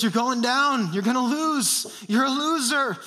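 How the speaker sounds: taunting voice of shame. Acted. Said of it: "Shame says".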